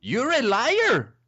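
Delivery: high, drawn-out accusation; put-on accuser voice. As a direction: stretch the accusation in a high character voice. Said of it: "Do the "you're a liar" part again".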